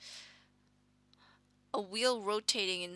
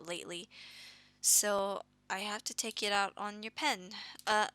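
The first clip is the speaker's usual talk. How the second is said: usual speaking voice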